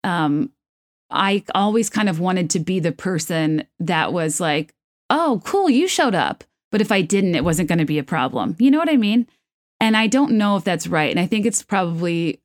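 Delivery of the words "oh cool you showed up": casual breezy. Retold or imitated. Casual breezy voice she wanted.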